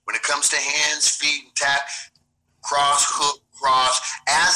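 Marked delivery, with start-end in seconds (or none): none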